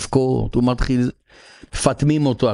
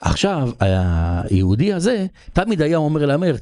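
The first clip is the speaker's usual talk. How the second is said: ordinary delivery, nothing marked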